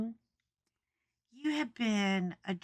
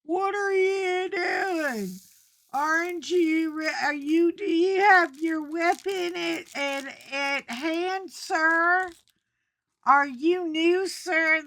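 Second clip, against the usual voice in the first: put-on high nervous kid voice; 9 semitones higher, 7 dB louder, slower than usual. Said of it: "Mister Boss Man gets out and he's like".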